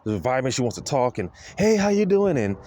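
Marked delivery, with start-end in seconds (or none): 1.6-2.4 higher, bright mock greeting; imitating her eager hello, a touch louder. Imitating the clerk greeting him the moment he walks in, eager.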